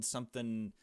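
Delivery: normal talking voice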